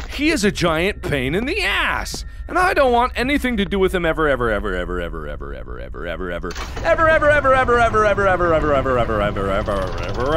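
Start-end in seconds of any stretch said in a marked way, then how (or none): 4.1-6.5 comically endless "ever", dropping low and soft; sinking repetition. Pretend. 6.8-10.4 restarted sliding repetition. Pretend. Restarts the endless repetition from high.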